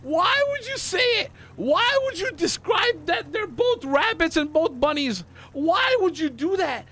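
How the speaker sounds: high, incredulous rant; outraged scolding. Real, not acted. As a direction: drag out each reproach in exasperated disbelief. Scolding partner for spoiling the answer.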